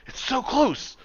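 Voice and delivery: very high-pitched excited squeal; excited shop owner. Acted. Shop owner after another explosion, excited. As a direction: squeal it like a frantic potion maker.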